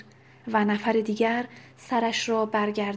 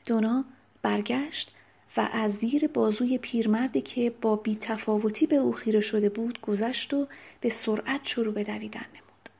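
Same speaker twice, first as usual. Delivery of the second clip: plain reading voice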